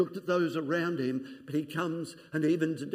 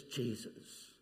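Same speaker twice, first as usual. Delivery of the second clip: hoarse whisper; 4 semitones lower, 13 dB quieter than usual. Acted. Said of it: "hoarsely, and as best he could".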